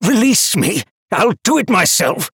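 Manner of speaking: high, loud raging shout; growling chained demon. A narrator's reading. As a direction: bellow like a chained beast. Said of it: "growling and pulling against his restraints".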